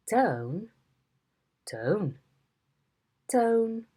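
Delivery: the word "tone" said seven times, each with a different tone; "tone" with each tone in turn; tone demos. Shown as a demonstration. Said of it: "there you have all the different tones with the word tone".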